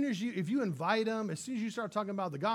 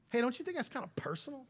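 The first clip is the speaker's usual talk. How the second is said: higher, fast, offended voice; defensive; 6 semitones higher, 4 dB quieter, faster than usual. Retold or imitated. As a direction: bristle at being asked. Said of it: "They go".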